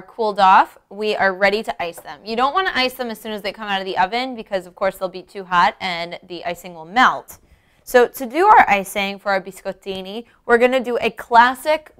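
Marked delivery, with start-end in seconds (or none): none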